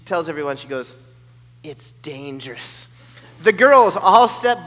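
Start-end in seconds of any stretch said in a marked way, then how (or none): 1.6-2.9 slow and much softer, like a secret; hushed ominous reveal. Retold or imitated. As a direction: lower the voice for the warning. [name] repeats ominous warning.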